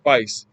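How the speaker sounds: "vice" for face, rhymed with rice; Cockney "vice", A turned to a long I; Cockney A sound. Shown as a demonstration. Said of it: "like rice".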